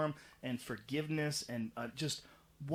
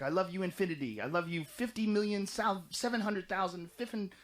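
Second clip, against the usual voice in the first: put-on higher, playful kid voice; lightly voiced child counting big numbers; childlike voice; 6 semitones higher than usual. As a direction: gush it like a small child. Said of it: "something like a kid would say".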